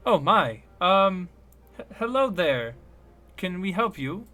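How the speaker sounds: a startled but polite little gnome; gnome woman scientist. Acted. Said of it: "she goes".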